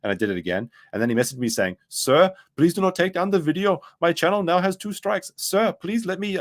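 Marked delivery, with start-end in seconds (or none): 1.9-6.4 high-pitched mock Indian accent. Indian man messaging the streamer about his strikes, pleading Indian accent. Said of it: "very indignant, uh, Indian man message me".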